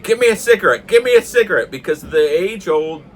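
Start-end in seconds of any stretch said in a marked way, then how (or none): 0.0-1.7 high, loud, repeated demand; imitated yelling. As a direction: yell it urgently, twice in a row. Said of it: "he's yelling at my dad".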